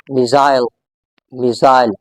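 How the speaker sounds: "missile" as mi-ZILE, long EYE vowel; British pronunciation. Shown as a demonstration. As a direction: say "missile" to rhyme with while. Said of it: "In British English".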